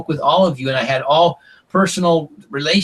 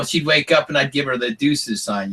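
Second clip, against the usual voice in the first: ordinary talk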